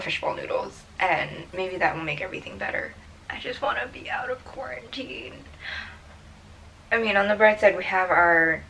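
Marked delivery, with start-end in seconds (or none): 3.3-5.4 breathy whispered sigh of longing. Real, not acted. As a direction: whisper it wistfully to yourself. Longing aside after plain talk.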